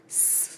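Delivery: س hissed softly and lightly, like a whistle; the letter س as a relaxed hiss; soft easy seen. Shown as a demonstration. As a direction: let the س out easy and soft, no pressing. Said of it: "سین کی آواز جو ہے وہ بہت آرام سے پڑھتے ہیں".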